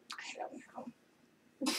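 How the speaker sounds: slow unvoiced whisper to herself. Real, not acted. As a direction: breathe it out, barely audible. Unsure, trailing off to herself.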